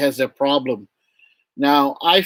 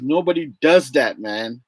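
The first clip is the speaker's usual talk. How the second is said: ordinary talk, nothing marked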